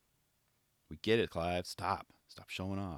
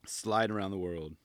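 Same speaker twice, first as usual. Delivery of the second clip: ordinary talk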